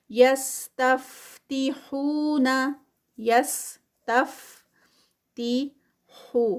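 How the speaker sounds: یستفتحون very slow, ہو held two counts; Quran recitation. Shown as a demonstration. Teacher reciting the verse for a student. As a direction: recite slowly, stretching the waw. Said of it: "ہو پہ ٹو counts ہیں نا".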